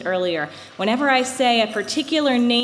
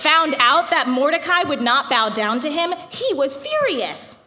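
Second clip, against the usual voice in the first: epic fantasy narrator; 2 semitones higher than usual. A narrator's reading. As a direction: tell it like an old legend. Reads the Purim story aloud.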